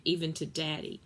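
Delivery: usual speaking voice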